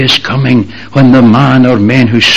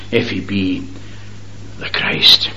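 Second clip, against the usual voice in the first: softer, trailing into a whisper; whispered taunt; 4 semitones lower, 6 dB quieter than usual. Acted. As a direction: hush the last words. Mockers' taunt.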